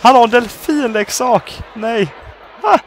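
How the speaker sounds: high, loud squeal of delight; excited amazement. Real, not acted. Player amazed by the dolphin toy, high delighted shouting. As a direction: shriek it out, slowing on the toy.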